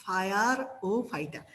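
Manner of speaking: ফায়ারোফাইটা very slowly, as "fire... o-phyta"; deliberately distorted pronunciation. Shown as a demonstration. Tutor repeating the trick for students, ফায়ারোফাইটা stretched out as "fire-o-phyta". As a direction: draw out each part of ফায়ারোফাইটা, starting with English "fire". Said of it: "উচ্চারণ করবো কি?".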